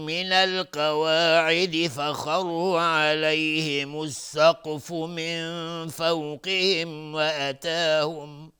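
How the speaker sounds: slow melodic tajwid chant; Quran recitation. Acted. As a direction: recite it slowly, drawing out the vowels. (تلاوت آهنگین قرآن)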